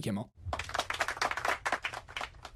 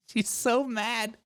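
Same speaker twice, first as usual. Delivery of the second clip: usual speaking voice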